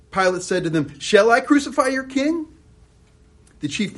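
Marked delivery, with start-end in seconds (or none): 1.0-2.5 high, loud mocking question; loud challenge. Pilate challenging the crowd, high and loud. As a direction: throw it back at the crowd.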